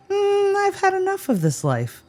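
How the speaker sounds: high-pitched voice for a donkey; fed-up donkey. Acted. Host voicing the runaway donkey, high fed-up voice. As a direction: start on a high, long hmm as the donkey. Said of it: "This little guy decides".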